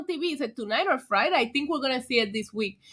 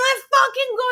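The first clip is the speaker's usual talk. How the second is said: hype yell; 13 semitones higher, 5 dB louder than usual. Retold or imitated. Retelling hyping up Moxley.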